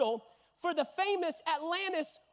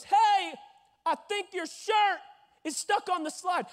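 high, raised voice calling across; lifeguard calling out; 6 semitones higher, 4 dB louder than usual. Retold or imitated. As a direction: call it up to someone stuck. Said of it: "he goes".

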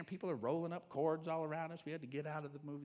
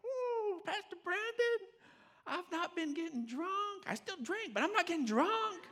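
high, excited voice of a grateful man; imitating the man; 13 semitones higher, 4 dB louder than usual. Pastor voices a congregant reporting good news, high and giddy. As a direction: mimic his giddy relief, pitched way up. Retells the man's happy report.

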